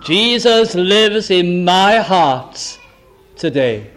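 slow, heavy stress on Jesus; solemn declaration. Shown as a demonstration. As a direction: build slowly to the heart of it. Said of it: "And I can also say".

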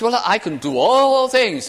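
high, loud, proud boast; brash bragging claim, pitched high and loud. Retold or imitated. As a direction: crow it like a braggart. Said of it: "Oh, what a boaster".

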